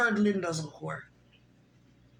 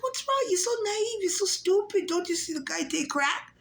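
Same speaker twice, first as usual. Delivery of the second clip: high, mocking voice of know-it-all friends; 10 semitones higher than usual. Friends scolding the naive speaker in a high mocking voice. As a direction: squeak it out like scolding gossips. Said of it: "They'd be like".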